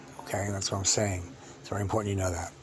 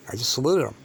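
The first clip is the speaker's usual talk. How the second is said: ordinary delivery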